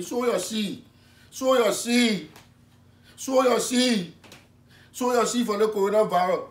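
loud, high insistent West African preacher call; preaching shout. Acted. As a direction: hammer the plea for money home. Pastor pressing for offerings.

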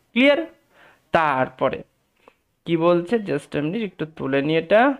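ordinary talk

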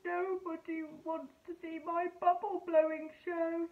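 mildly sad little fish voice; a touch of sulky sighing; sighing Puffy. Acted. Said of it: "he sighed".

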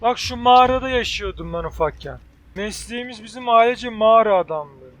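usual speaking voice

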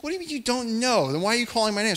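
high, loud baffled yell; exasperated shout. Retold or imitated. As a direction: shout back at him in disbelief.

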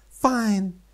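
short high clipped reply; high character voice. Pretend. The girl agreeing to give a tour, high voice.

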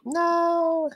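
high, loud protesting no. Real, not acted. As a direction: cry out in protest. Pleading he not start yet.

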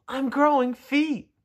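high, slow, proud; excited high tadpole. Acted. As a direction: show off new feet proudly. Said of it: "said the tadpole".

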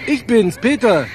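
tinny voice through a phone line. Acted. Anruf über Telefonzelle. (Stimme durchs Telefon)

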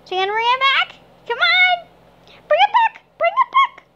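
high, loud coaxing call to the cat; sing-song call to bring the cat over; squeaky "come on" to a pet. Real, not acted. Calling cat to fetch.

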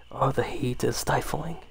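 usual speaking voice

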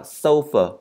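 "sofa" slowly, strong SO and weak "fuh"; careful SOH-fuh for "sofa"; stress on SO. Shown as a demonstration. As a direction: model "sofa" falling after the first syllable. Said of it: "cho âm rơi vào chữ so đó".

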